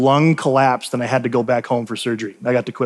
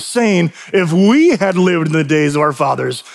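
emphatic, higher-pitched scripture warning; stern preaching; 4 semitones higher than usual. Real, not acted. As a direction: preach a solemn rebuke, stretching key words.

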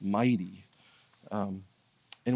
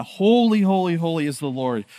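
first holy called out much higher and louder; emphatic holy; 5 semitones higher, 9 dB louder than usual. Shown as a demonstration. Pastor showing how repetition shouts holy, emphatic call. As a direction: yell the first holy like all caps. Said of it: "all caps it or yell it".

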